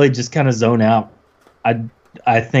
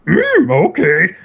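high, slow croaky Yoda try; 12 semitones higher, slower than usual. Retold or imitated. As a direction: start from a Yoda impression. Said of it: "do your best Yoda impression".

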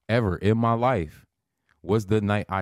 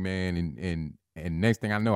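usual speaking voice

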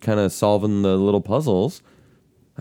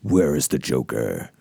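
Batman voice; 12 semitones lower, 4 dB quieter than usual. Shown as a demonstration. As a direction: growl the question like Batman.

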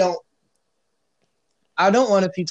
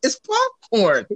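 high, slow pleading squeak; much slower than usual. Retold or imitated. Mock pleading with police.

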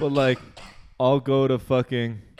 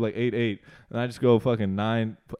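normal talking voice

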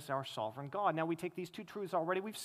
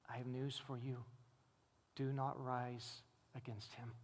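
softer, lower and grave; hushed warning; 5 semitones lower, 10 dB quieter than usual. Pretend. Preacher answering unbelievers on judgment day, softer and grave. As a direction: lean in and warn them quietly.

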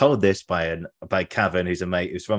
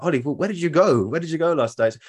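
curious mates; 9 semitones higher, 3 dB louder than usual. Retold or imitated. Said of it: "they were like".

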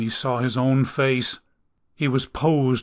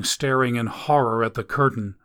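ordinary delivery

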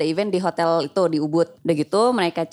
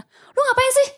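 angry. Retold or imitated. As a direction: confront her, upset. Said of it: "marah-marah". (marah menegur)

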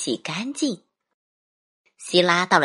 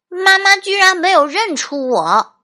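put-on high, loud little boy voice; dismayed child; 12 semitones higher, 7 dB louder than usual. A narrator's reading. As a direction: exclaim in disbelief as a kid. Boy shocked mother didn't recognise him. (高声惊讶的小男孩声音)

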